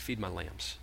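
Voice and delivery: low, soft instruction; gentle command. Acted. Jesus telling Peter by the fire, low and soft. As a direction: say it quietly, close.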